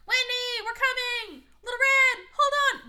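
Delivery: high, loud hammed-up shouting; calling to Wendy, loud mock cries; mock yelling. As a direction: yell it like heroes who do nothing. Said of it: "they're just yelling".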